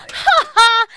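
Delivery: loud, high, hammed-up "Ha ha!"; hearty mock laugh. Applicant laughing loudly at the guard. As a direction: laugh big like a pushy traveller.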